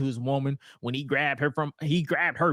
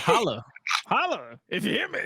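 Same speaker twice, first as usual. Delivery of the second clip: high-pitched wrestling catchphrase; Scott; 6 semitones higher than usual. Retold or imitated. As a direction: call it out high to the crowd. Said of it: "So did he say, Holler".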